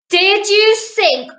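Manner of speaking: slow, loud, high accusing roar; lightly hammed-up roaring admiral; admiral's roar. A narrator's reading. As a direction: roar each word out slowly. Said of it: "roared the admiral".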